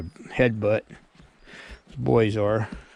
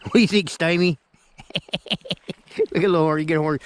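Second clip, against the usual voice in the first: ordinary talk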